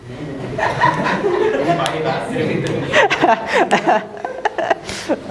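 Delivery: drawn-out amused laugh; long laugh. Real, not acted. Teacher laughing with students over the lyric. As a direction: laugh freely at the absurd lyric.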